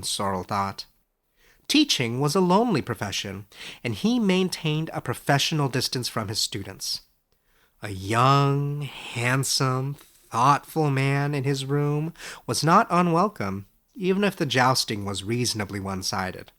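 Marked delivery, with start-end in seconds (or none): none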